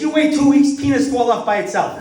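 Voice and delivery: high, loud mock Chinese accent; Chinese doctor's punchline. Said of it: "He says".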